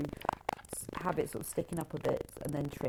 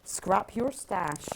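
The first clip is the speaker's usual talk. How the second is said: slow, slight Bristol accent; a bit Bristolian; 2 semitones higher, much slower than usual. Real, not acted. Reading a book title to a fellow West Country quilter, slightly Bristolian. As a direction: draw it out with a touch of Bristolian. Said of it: "That was a bit Bristolian".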